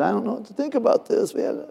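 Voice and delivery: high, tearful voice without extra volume; imitated crying. Man given money reacts to his pastor, imitated tearful voice. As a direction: act out a man choked up by a gift. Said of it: "And he started crying".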